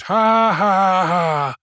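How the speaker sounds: high, sustained mock "ha ha ha"; read-out laughter. A narrator's reading. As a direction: act the crowd's jeering laugh, stepping down in pitch. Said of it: "The undergraduates laughed".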